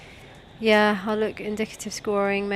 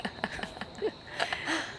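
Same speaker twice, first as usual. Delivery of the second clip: whispered laugh; 9 semitones higher, 8 dB quieter than usual. Real, not acted. Laugh after whispered joke.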